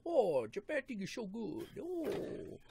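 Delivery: high, hammed-up Chinese accent; mock Chinese accent. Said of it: "stereotypical Chinese accent".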